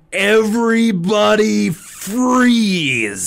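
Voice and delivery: slow, high shouted order; yell. Retold or imitated. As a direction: bellow it to stop everyone. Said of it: "he just yells".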